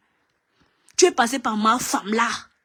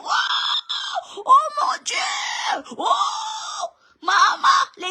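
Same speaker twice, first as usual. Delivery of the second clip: shrill mock sob, very high and slow; fake-cry like a drama queen; mock crying wail; much slower than usual. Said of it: "les cris de ça". (faux pleurs aigus et exagérés)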